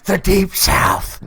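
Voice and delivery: loud, drawn-out mock announcement. Co-host joking about a driver's accent, hammed-up drawl. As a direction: stretch it big and loud. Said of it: "From little Nicky".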